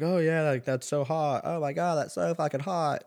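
lightly mocked girly enthusiasm; swooning girls. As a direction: imitate girls gushing over a hot guy. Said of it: "the girl's like".

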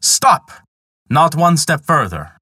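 loud, high shout to halt. Acted. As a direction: bark the command to stop.

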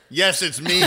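laughing impression. Retelling Homer's answer, laughing.

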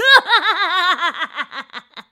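loud high witch cackle; evil cackle. Acted. Witch gloating over the captured boy, loud evil cackle. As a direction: cackle wickedly, throw it out. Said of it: "The evil old woman laughed".

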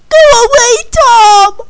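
high, loud wail of dismissal; upset shout. Acted. Candy sending Tom away after their fight, upset shout. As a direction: drive them off, loud and high.

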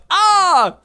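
loud, high mock scream; exclaimed ah. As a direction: shout it like written exclamation marks. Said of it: "exclamation marks".

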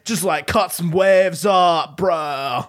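performative California surfer dude, drawn out at the end; California surfer-bro accent. Retold or imitated. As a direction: imitate a California bro, stretching out the last phrases. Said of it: "he talked like that".

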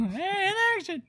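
high, excited call to action; mock director call. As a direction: shout it like a hammy director.

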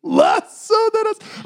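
very high, stretched-out squeal; squealed punchline. Acted. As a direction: whoop it slowly, way up high. Repeats punchline as whooping squeal.

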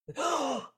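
sudden startled intake of breath; sharp gasp. Shown as a demonstration. Podcaster shows how she woke from the dream, sharp gasp. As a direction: gasp as if jolted awake. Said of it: "like that, like a breath".

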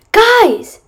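high, loud panicked scream. A narrator's reading. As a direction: yell it out in panic. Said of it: "Max screamed".

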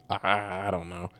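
soft, low, hesitant mock answer; hushed, unsure retold answer; meek reply. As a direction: imitate a small helpless reply under questioning. Medium's helpless reply to yelling.

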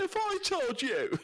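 high, slow, theatrical presenter voice; hammed-up Clarkson; Clarkson impression. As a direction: stretch it out like Clarkson. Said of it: "bad accents".

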